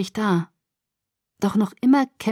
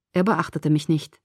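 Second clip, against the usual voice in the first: usual speaking voice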